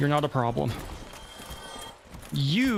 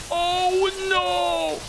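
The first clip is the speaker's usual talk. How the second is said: high, drawn-out cry of dismay; alarmed wail; 12 semitones higher than usual. Real, not acted. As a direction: wail it high as disaster hits. Game attack goes badly wrong.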